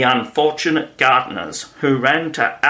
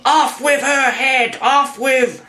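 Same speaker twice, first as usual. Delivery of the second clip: high-pitched furious screech; very high screamed command, cut off; screaming; 13 semitones higher, 3 dB louder than usual. A narrator's reading. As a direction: scream the order as an enraged queen. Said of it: "began screaming".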